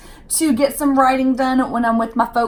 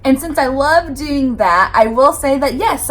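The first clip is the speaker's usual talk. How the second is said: ordinary talk, nothing marked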